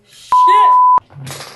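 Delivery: sudden high yelp of pain; pained shout. Real, not acted. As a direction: blurt it out sharply. Sudden pain while brushing wig.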